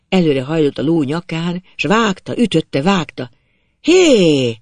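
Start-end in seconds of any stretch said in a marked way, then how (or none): 3.8-4.6 long, loud, raised-pitch hail; shouted call. A narrator's reading. Old man yelling at the galloping rider, loud shout. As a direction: bellow to stop a passing rider. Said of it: "ordított rá Bucsi bátyja".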